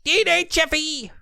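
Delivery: very high, drawn-out Australian caricature; mock Australian accent. Podcaster imitating the District Nine actor, exaggerated Australian accent. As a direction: stretch it into a squeaky, silly Australian greeting. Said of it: "he's got that weird Australian accent".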